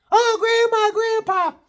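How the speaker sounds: put-on very high shrieking child voice; high wailing kid squeal; screaming kid. Kids screaming for grandparents.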